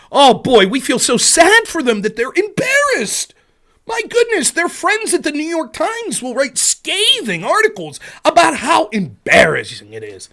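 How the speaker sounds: sarcastic pity, pitched high with big swoops; exaggerated sarcastic lament; mock sympathy. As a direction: pour on the fake dismay, stretching key words. Mock sympathy for rich Republicans.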